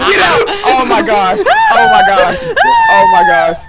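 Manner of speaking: loud, very high frantic yelling; panicked screaming. Real, not acted. Player ordering team to escape, panicked screaming.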